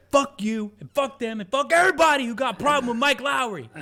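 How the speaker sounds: very high, loud shouted cursing; hammed-up yell at everyone; mock furious rant. Will Smith cursing everybody in the police car, hammed-up shout. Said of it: "he's like".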